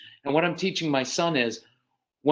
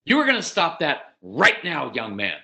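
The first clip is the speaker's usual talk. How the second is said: stern, louder order, dropping on the last words; acted scolding, hard stress on each demand; typical parental command; 3 dB louder than usual. Shown as a demonstration. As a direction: lay down the law to a child. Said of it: "Typically, we do this".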